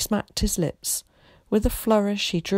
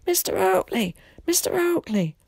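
shouting; 12 semitones higher than usual. A narrator's reading. Old man runs after Tom and Willie, shouting. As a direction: hail someone from down the road, voice pitched high. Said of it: "someone began shouting at them".

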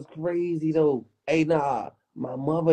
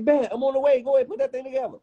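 pitched-up friend on the phone; quick retold reply; high imitated Craig; 9 semitones higher, faster than usual. Craig quoted answering on phone.

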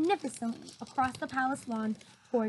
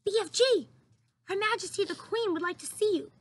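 calling out; 7 semitones higher than usual. A narrator's reading. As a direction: call out across the garden to the giant. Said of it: "called out".

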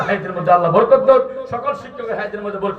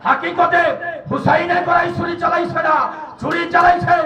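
impassioned waz preaching; 8 semitones higher than usual. Acted. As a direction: preach it in a high sustained chant, stretching the line ends. Preaches at full chanting force. (সুর করে আবেগী ওয়াজ)

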